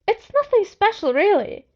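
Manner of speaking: high, loud, hurried denial; too quick, wincing. A narrator's reading. Narrator rushes to deny his gift, high and loud. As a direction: brush it off too fast. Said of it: "I replied a little too quickly with a wince".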